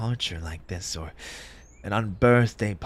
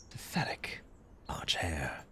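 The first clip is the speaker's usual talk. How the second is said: whispered to himself, bitter; self-scolding whisper; 5 semitones lower, 13 dB quieter than usual. Acted. The grieving hare whispers scorn at himself. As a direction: mutter the insult at yourself under your breath.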